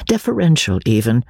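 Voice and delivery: plain reading voice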